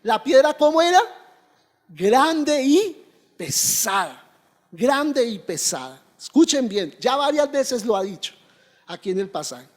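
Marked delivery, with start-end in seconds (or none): 1.9-6.0 slow emphatic answer. Acted. Congregation-style answer, slowed.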